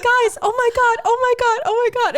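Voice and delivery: high, tearful overwhelmed cry of happiness; retold bawling. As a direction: relive sobbing with overwhelmed joy. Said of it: "I just start bawling".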